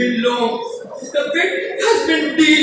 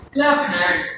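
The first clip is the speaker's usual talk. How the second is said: low, falling, restrained command; subdued refrain; 7 semitones lower than usual. Acted. Poet repeating the call to the people, low and settled.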